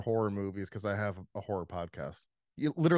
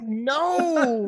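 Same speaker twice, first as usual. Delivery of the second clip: loud protest; 14 semitones higher, 7 dB louder than usual. Real, not acted. Protesting being teased.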